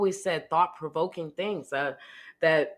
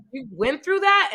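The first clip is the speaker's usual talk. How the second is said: higher and louder, mock shock; shocked therapist; 9 semitones higher, 7 dB louder than usual. Therapist quoted reacting to her story, mock shock. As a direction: ask it in disbelief. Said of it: "she's like".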